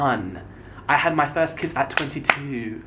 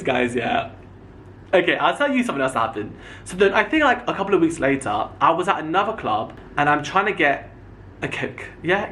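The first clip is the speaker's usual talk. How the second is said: usual speaking voice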